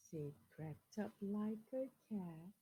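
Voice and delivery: put-on lively picture-book reading to children; storyteller voice for kids; story reading. A narrator's reading. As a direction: read it out to the class with expression. Reads a picture book aloud.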